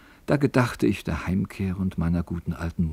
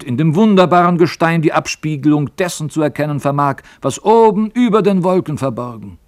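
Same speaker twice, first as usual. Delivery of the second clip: put-on higher and louder, an old man flaring up in anger; angry old miner; 4 semitones higher, 4 dB louder than usual. Old miner scolding young Elis, angry and loud. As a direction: snap it out, offended. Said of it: "rief der Alte erzürnt".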